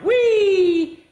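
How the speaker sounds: high, loud gleeful squeal; fly through the air squealing with delight; joyful whee. A narrator's reading. Plate thrown as frisbee squeals with glee.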